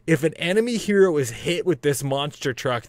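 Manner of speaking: ordinary delivery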